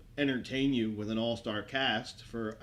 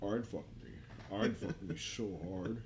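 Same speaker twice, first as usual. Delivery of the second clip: Buffalo Bill imitation; 6 semitones lower, 10 dB quieter than usual. Buffalo Bill quote acted.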